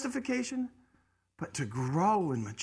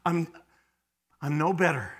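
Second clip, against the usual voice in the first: usual speaking voice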